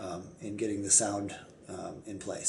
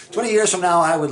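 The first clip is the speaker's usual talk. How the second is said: higher and louder, laughing restart; excited; 8 semitones higher, 11 dB louder, faster than usual. Real, not acted. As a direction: launch it again, pumped up. Restarts the answer after a laugh.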